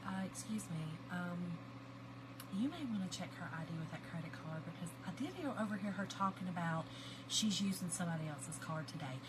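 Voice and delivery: soft, low, discreet voice; confidential tip-off. Retold or imitated. Cousin tipping off the checkout girl, quietly. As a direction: lean in and quietly inform on someone. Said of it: "Linda says to the little girl".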